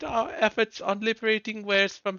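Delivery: high pompous villain speech; posh idiot voice. Acted. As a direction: gloat like a silly rich schemer. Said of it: "I get to do his voice again".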